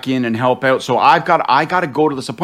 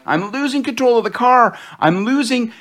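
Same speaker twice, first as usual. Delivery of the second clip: high, strained alarm; retold panic; 10 semitones higher than usual. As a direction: play it like the car is spinning out. Losing control of the car.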